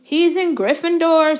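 plain reading voice

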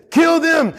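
high, sudden mob cry; mock mob shout. Congregation turning on Joshua, high mock mob shout. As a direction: shout it like an angry crowd. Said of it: "all of a sudden they say".

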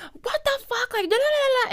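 very high squeaky outburst; mock rant. She imitates ranting alone in her apartment, mock pissed. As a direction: imitate yourself going off.

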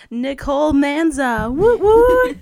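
high, loud hype cheer; excited cheer. Real, not acted. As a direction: whoop and cheer her guest in. Hypes up her guest.

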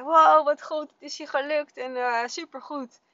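high, loud wow, then easing off; retold motherly cheer; excited praise. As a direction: praise a child with delight. Mother praising child's success.

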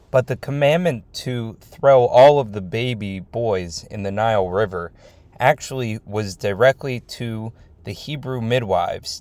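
ordinary talk

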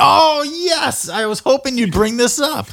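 high, loud gleeful delight; host thrilled the topic came up; excited. Real, not acted.

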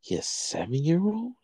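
slow, high disbelieving question; incredulous cop. Retold or imitated. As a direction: draw it out, rising in disbelief. Retold cop's disbelieving question.

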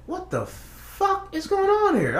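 very high, slow, drawn-out what the fuck; total disbelief. Retold or imitated. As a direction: stretch it out high in shock. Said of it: "I'm just like".

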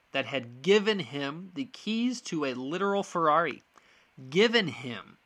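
given leaned on hard, pitch lifting on the first given; stress on given. Real, not acted. Podcaster to Sooner fans, hammering given. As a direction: press down on given each time. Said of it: "I wanna stress the word given".

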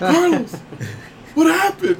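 high, alarmed retold cry; mock startled alarm. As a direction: imitate a brother who got a scare. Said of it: "I scared the shit out of him".

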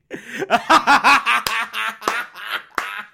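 long, high, helpless laughing fit; real uncontrolled laughter; big belly laugh. Real, not acted. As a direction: burst out laughing at your own joke. Cracks up at own joke.

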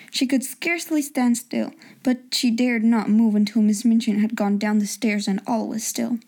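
plain reading voice

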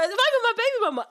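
high, loud mock chat-up voice; imitating the flirty customer. Customer's cheesy line to the cashier, high and loud. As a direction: play the stranger's cheesy compliment big. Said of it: "He goes".

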